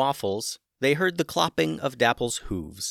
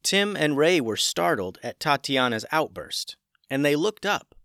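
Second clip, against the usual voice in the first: usual speaking voice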